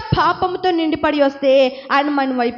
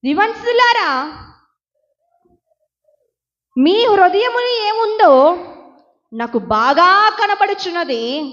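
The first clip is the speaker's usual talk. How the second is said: God addressing sinners; 3 semitones higher, 4 dB louder, slower than usual. Acted. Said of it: "ఈ రోజున ఆయన అంటున్నాడు".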